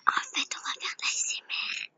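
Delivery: playful ASMR whisper, fast; mock ASMR hush for the viewers. Streamer pretending to do ASMR for viewers, whispering. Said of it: "je parle doucement".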